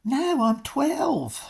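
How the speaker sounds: high, drawn-out mock protest; exaggerated, slow high-pitched joke answer; mock retort. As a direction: stretch it out high, playing the silly comeback. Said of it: "My first inclination was to go".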